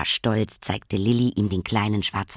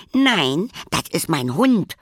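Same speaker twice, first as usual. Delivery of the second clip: high, louder defiant no; little sister talking back; stubborn contradiction; 6 semitones higher, 4 dB louder, slower than usual. Acted. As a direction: insist like a stubborn toddler. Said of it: "widersprach Karlchen".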